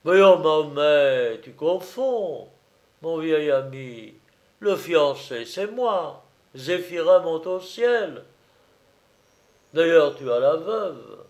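put-on hearty old soldier setting a friend straight; loud, drawn-out opening, then firm; drum major. Major correcting his confused old friend, hearty and emphatic.

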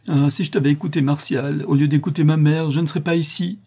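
high-pitched, anguished wail of regret; heart-rending cry. A narrator's reading. Condemned daughter giving in to her terrors, heart-rending cry. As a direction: let terror finally break out. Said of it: "d'une voix déchirante".

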